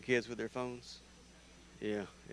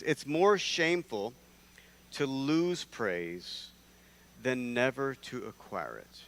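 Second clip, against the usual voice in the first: slow deliberate reading; slower than usual. Acted. Reading Pliny slowly.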